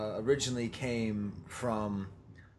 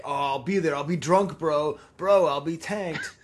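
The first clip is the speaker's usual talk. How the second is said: put-on drunk bro voice; 2 semitones higher, 7 dB louder than usual. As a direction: ham it up like a tanked bro. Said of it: "all their responses were like".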